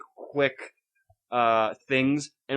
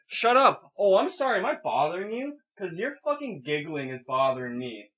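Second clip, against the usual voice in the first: mock irritated retort; 5 semitones higher than usual. Telling off gigglers in the cinema, mock irritated. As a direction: play up sarcastic annoyance at being bothered.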